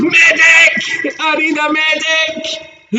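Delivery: high, loud shout of "medic"; American soldier voice. Shown as a demonstration. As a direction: call for a medic like a wounded soldier in a film. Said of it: "The only thing I can do, and hopefully do well".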